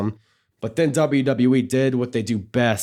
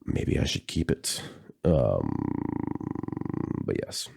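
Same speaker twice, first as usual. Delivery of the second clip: nasal raspy voice; 3 semitones lower than usual. Real, not acted. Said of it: "enduring my nasally voice ... nice and raspy".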